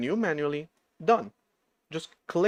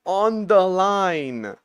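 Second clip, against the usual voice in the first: slow, loud stretched "on the line"; drawn out emphasis; 5 semitones higher, 8 dB louder, much slower than usual. Real, not acted. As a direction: drag out "on the line" loudly. Mocking own oversight.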